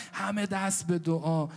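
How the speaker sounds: ordinary talk, nothing marked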